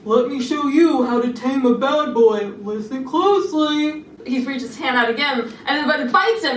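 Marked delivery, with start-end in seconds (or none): 0.1-4.1 slow, self-assured showing-off voice; smug boast before reaching for the squirrel; cocky confidence. Retold or imitated. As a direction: lay it on slowly like an expert tamer. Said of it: "Julian goes".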